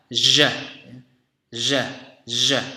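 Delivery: "Zh" as a buzzing version of sh; voiced ZH sound. Shown as a demonstration. Teacher contrasting it with sh for learners, voiced ZH. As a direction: hold each "Zh" with vibrating vocal cords. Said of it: "getaran pita suara atau bunyi voice".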